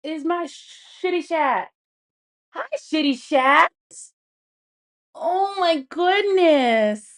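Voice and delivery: sing-song pet voice, stretched out slowly; pet talk. Real, not acted. Cooing at her cat on stream.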